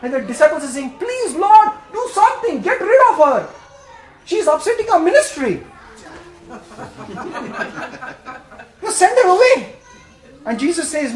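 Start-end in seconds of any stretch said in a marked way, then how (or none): 1.0-5.6 whiny disciples. Retold or imitated. Disciples voiced complaining. 5.7-8.8 breathy laugh. Real, not acted. Preacher laughs at own line.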